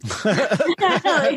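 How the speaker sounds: a short real laugh, falling off. Real, not acted. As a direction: laugh at your own joke. Laughing at her own joke.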